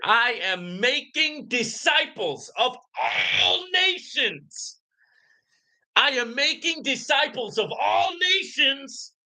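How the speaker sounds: slow, booming preacher cadence with a crying edge; preaching proclamation. Acted. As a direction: proclaim it like a revival preacher, building to a shout. Preacher-style biblical proclamation.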